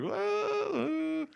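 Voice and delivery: very high whoa; a high cartoon whoa; mock amazement.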